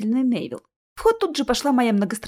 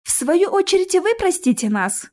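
high, raised voice of a polite woman; loud, formal reply; 9 semitones higher, 4 dB louder than usual. A narrator's reading. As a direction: call out politely through the door. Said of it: "громко сказала Алиса". (громко и подчёркнуто вежливо)

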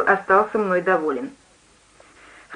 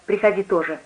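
plain reading voice

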